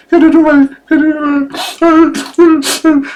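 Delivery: high, loud frantic plea; girl screaming in mock terror; mock panicked scream. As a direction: shriek to get the spider off.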